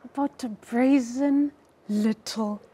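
slow, loud, smirking read with a laugh; the mother mocks the father's taste with a slow smirk. Shown as a demonstration. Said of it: "I like this smirk".

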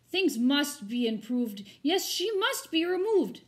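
loud, fed-up mayor; mayor's outburst. Acted. The mayor huffing at the cranky crowd, loud and fed up. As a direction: bark it out like a cross mayor.

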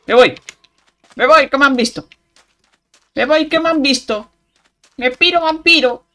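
high loud panicked cry; panicked fleeing. Real, not acted. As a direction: shout it while running away. Fleeing mobs, shouting.

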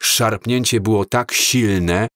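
ordinary delivery

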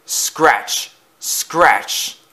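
sharp, isolated noise word; scratching noise. A narrator's reading. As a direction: say it like a sudden scrape. Imitates strange noise in the night.

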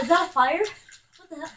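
usual speaking voice

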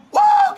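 a very high, loud woo of joy; victory whoop. Real, not acted. Gamer celebrating a big play, victory whoop.

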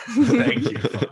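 high, loud laughing thanks. Real, not acted. Thanking the host for the barbecue idea, laughing. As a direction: burst out thanking through a laugh.

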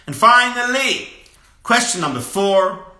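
loud and high, building to the last question; big announcement. Real, not acted. Rabbi booming out the last of his four questions, excited. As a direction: raise the voice to announce the finale.

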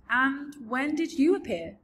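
put-on bright young girl; Sophie voice. A narrator's reading. Sophie character voice.

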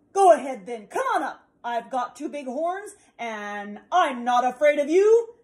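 loud, bold dare; big goat daring the troll, loud; defiant challenge. Acted.

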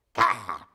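loud, harsh monkey cry; imitated monkey screech. Monkey suddenly noticing the audience, loud imitated screech. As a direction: let out a sudden monkey roar. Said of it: "and goes".

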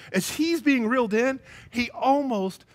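ordinary delivery

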